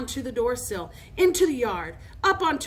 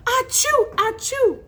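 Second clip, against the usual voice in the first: two high, loud fake sneezes; mimicked sneezes; 13 semitones higher, 7 dB louder, slower than usual. A narrator's reading. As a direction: sneeze twice theatrically. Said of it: "all of a sudden he'd sneeze".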